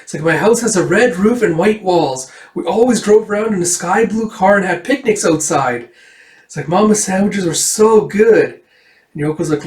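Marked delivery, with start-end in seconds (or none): 0.2-5.9 put-on high-pitched, bragging kid voice; acted child's gushing pride; proudly boasting child. Voices Erica boasting of home. 6.7-8.6 high, loud, drawn-out delight; gushing child. Acted. Voices Erica gushing over memories.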